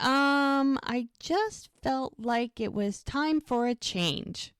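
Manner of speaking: high, drawn-out mock hesitation; stall, then slowly offer a lame excuse; hesitant excuse. Mel explaining to the ladies why she left, hesitant excuse.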